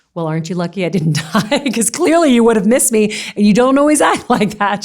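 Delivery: loud teasing retold through laughter; joking. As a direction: crack up while retelling your own wisecrack. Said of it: "has a really hilarious sense of humor".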